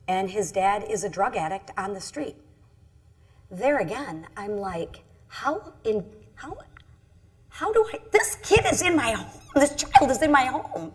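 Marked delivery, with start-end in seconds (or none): 5.3-10.9 high, loud retold disbelief; disbelieving exclamation. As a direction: exclaim it higher and louder, stunned. Retells her shocked inner question.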